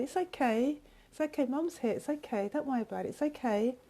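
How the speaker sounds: put-on gently reassure, as if to a small child; soothing mum. Mum comforting a child who has fallen over, soothing.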